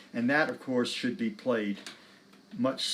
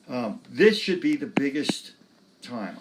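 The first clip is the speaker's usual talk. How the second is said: ordinary delivery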